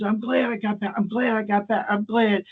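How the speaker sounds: high-pitched, overcome babbling; imitated weepy drunk. Drunk visitor going down the hall, mock-tearful relief. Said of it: "drunks can cry at the drop of a hat".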